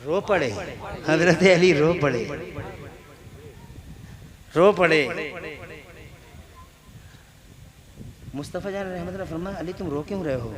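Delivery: soft, intimate storytelling voice; hushed story narration. Acted. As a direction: draw them in close and quiet. Hushed telling of the miracle. (धीमी आवाज़ में क़िस्सा)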